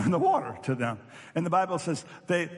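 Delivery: usual speaking voice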